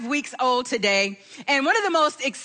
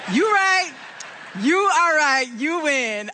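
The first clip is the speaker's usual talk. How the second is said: laughing concession; 2 semitones higher, slower than usual. Real, not acted. Conceding to late grandmother, laughing.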